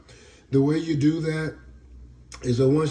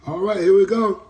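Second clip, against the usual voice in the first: psyched up; 5 semitones higher, 7 dB louder than usual. Real, not acted. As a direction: pump yourself up before something gross. Psyching up before the dose.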